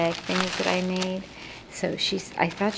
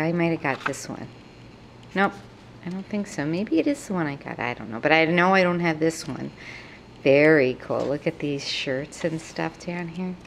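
normal talking voice

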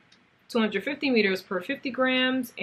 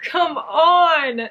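high, loud come on through laughter; laugh-cry in disbelief; laughing cry of joy; 9 semitones higher, 7 dB louder than usual. Real, not acted. Vlogger overjoyed the yarn was meant for her, laughing cry.

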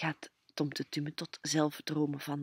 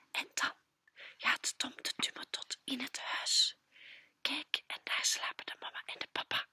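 soft voiceless whisper, higher; whispered narration; 7 semitones higher, 5 dB quieter than usual. A narrator's reading. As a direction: hush so the parents stay asleep. Whispering near sleeping parents. (fluisterend vertellen)

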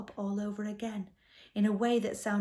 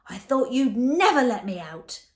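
loud, raised monster bellow; 6 semitones higher, 11 dB louder than usual. A narrator's reading. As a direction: boom it out, pitch climbing. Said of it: "he bellowed".

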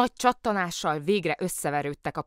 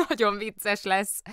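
gleeful; 5 semitones higher, 4 dB louder, slower than usual. A narrator's reading. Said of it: "örvendezett Kóbor Muki".

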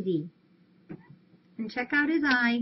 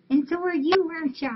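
cooing praise; 7 semitones higher, 6 dB louder, faster than usual. Real, not acted. Praising a cat.

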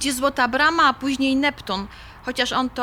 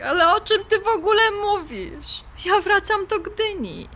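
baffled; 7 semitones higher than usual. Acted. She is baffled by Jasiek.

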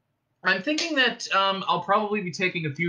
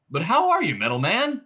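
high, louder opening that drops back; upbeat greeting; 5 semitones higher, 4 dB louder than usual. Acted. Ford greets Marvin lying in the dust, upbeat. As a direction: hail the robot brightly.

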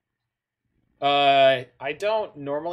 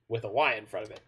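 ordinary delivery

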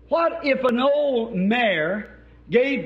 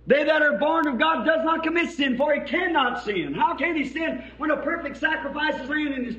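fiery preaching; 7 semitones higher than usual. Acted. Preacher crying out to his tabernacle congregation, fiery preaching. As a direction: shout it out like a revival preacher.

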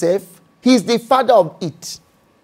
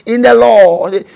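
ordinary delivery

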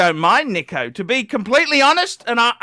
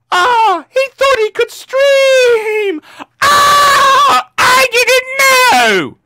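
very high, loud, slow mock wailing; mocking crybaby; 14 semitones higher, 4 dB louder, much slower than usual. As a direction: whine like a crying brat he's ridiculing. Mocking rival Tim as crybaby.

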